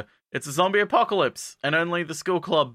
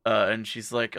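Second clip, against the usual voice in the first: ordinary talk, nothing marked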